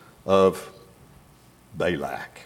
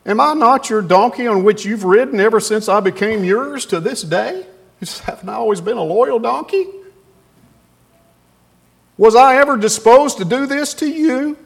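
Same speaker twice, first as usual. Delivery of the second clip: higher, hurt donkey voice; loyal donkey pleading; 9 semitones higher, 4 dB louder than usual. Acted.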